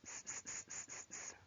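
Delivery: long unvoiced snake hiss; hissing s sounds. Shown as a demonstration. Teacher hissing to imitate the snake. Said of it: "On entend le s".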